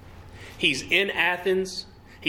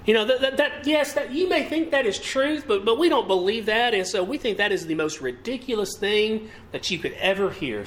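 mocking scoffers; 6 semitones higher than usual. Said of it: "Some just laughed at him and said".

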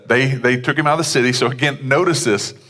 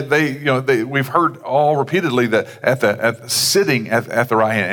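ordinary talk, nothing marked

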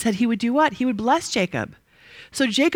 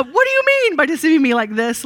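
higher and louder, an accusing demand; 6 semitones higher, 5 dB louder than usual. A narrator's reading. Teacher reading Laban's accusation to Jacob, demanding and louder. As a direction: read it as Laban confronting Jacob. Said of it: "Laban demanded".

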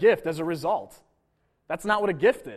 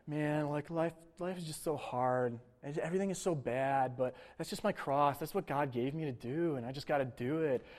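mopey mock lament, much softer; self-pitying; 3 semitones lower, 9 dB quieter than usual. Preacher mocking a believer who calls troubles his cross, softly whining. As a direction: sigh it out like a sorry-for-yourself martyr. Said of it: "some sort of self-pitying humility".

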